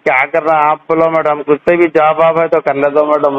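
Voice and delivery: normal talking voice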